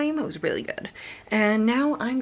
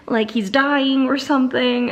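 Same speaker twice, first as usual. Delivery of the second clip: normal talking voice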